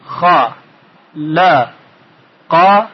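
kha, la, qa as three separate short syllables. Shown as a demonstration. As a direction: break "خلق" into kha - la - qa with pauses. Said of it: "اگر بخوایم بخش کنیم".

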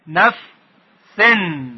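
teacher modelling نفسن; slow syllabified. Shown as a demonstration. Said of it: "کلمهٔ نفسن".

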